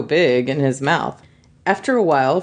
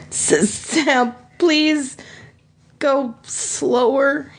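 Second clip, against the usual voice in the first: pleading; 6 semitones higher, much slower than usual. Acted. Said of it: "he pleaded".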